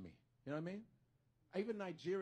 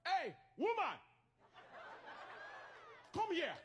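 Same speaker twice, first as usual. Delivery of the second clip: bossy Nigerian cousin; 11 semitones higher, slower than usual. Retold or imitated. Male cousins ordering a woman around, bossy Nigerian accent. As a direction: call out bossily with a Nigerian accent. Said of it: "the way they talk to women".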